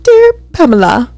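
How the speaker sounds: a touch of pompous butler reading; mock formal letter voice. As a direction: read it like a stiff butler. Mock reading apology letter aloud.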